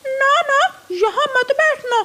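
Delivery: shooing away. Acted. Pigeon shoos crow away. (ऊँची आवाज़ में भगाना)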